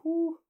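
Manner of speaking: loud, high-pitched whew of relief; acted sigh of relief, high and loud; relieved whew. Narrator after the robber rode off, loud relieved whew.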